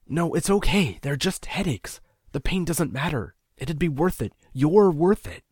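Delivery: a lightly voiced desperate young man; placating plea. A narrator's reading. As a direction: soothe her before she pulls away. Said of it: "placating alarm".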